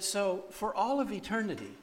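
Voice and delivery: imitating an earnest, high-pitched lecture; emphatic admonishing. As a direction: press the point like a no-nonsense teacher. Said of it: "she can't yell at me again".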